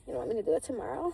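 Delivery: fast, loud teasing question; playful taunt. Retold or imitated. Partner teasing her in bed, fast loud taunt. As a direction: toss it out quickly and teasingly.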